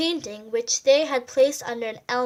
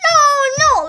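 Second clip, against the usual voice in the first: high, loud little cry of protest; Little Mouse cries out high and loud; 13 semitones higher, 13 dB louder than usual. A narrator's reading. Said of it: "Little Mouse cried".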